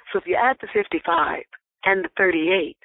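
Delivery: normal talking voice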